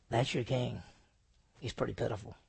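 soft, low, pitying "That's your king"; pitiful. Shown as a demonstration. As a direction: show him as a pitiful sight.

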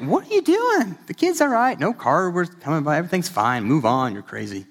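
high mocking voice scoffing at the parent; mocking young self. Younger self mocks a parent scolding a kid, high and scoffing. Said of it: "I used to think".

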